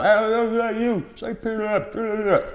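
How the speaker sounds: put-on high, slow childish taunt; high kids' voice calling through the screen; mimicking kids. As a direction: coax the parrot like a cheeky kid. Kids shouting at parrot.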